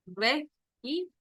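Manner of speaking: "regímenes" in slow pieces, high rising GÍ; shifted plural stress. Shown as a demonstration. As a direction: break "regímenes" as re... GÍ... menes, lifting the stressed GÍ. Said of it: "una de sus sílabas al ser pluralizadas". ("regímenes" lento y por sílabas, con acento alto en GÍ)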